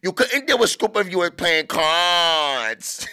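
mock nineties rap flow with drawn-out syllables; stretched-out hard rapper. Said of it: "stretch out a one-syllable word to make it sound hard".

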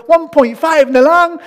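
high, slow outraged reading of the scale; indignant discovery. Retold or imitated. Buyer finds he was cheated, high and slow. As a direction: let the shock rise as you read the weight.

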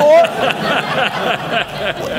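a high, joyful burst of laughter; delighted laugh. Real, not acted. Preacher delighted at the story.

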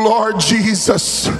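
ordinary talk

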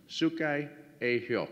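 "Sukai Ayo" said very slowly, each syllable spelled out for the audience; slow, careful repeat. Shown as a demonstration. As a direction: stretch "Sukai Ayo" out slowly so they can catch it.

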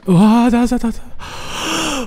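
high cry breaking off in a gasp; real jump-scare outburst; startled panic. Real, not acted. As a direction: yell high, then lose the volume. Enemy suddenly appears.

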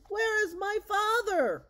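high, loud, slow frantic cry; frantic shout. Acted. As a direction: call out for the lost father. Son sees father turned dragon.

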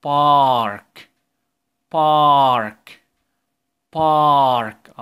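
star said loud and slow, ar stretched; slow loud model reading; drawn-out teaching read. Shown as a demonstration. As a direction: stretch each word so kids copy. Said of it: "يلا كرروا معايا".

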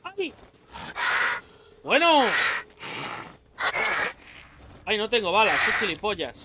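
frantic shouts, out of ammo; panicking gamer. Real, not acted. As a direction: yell in real panic as enemies close in. Ambushed by enemies, out of ammo.

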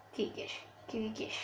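whisper. Real, not acted. Urging player during match.

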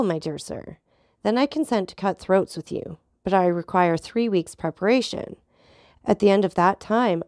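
usual speaking voice

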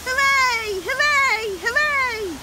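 high, loud repeated hoorays; cheering. A narrator's reading. Everybody celebrating with the princess, cheering. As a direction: cheer like a whole party. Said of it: "everybody cheered".

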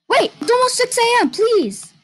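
high panicked begging; panicked plea. Real, not acted. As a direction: beg in a rising panic. Desperate near end of night.

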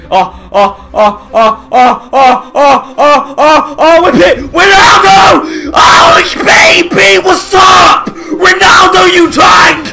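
building from plain to screaming; goal celebration. Real, not acted. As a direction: climb to a screaming cheer.

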